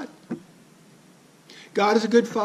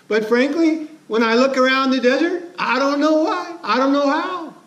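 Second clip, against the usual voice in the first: higher, baffled voice, a touch of helpless doubt; bewildered Moses; 6 semitones higher than usual. Retold or imitated. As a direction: Moses shrugs at the empty desert, pitched up and unsure. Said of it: "And Moses goes".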